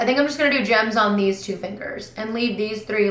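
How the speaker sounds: ordinary delivery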